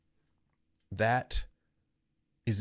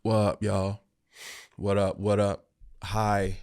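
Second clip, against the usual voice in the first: ordinary delivery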